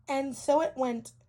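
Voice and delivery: ordinary delivery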